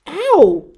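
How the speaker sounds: high, loud sharp ow; pained yelp. Acted. Fred knocking heads with Brother, loud yelp of pain.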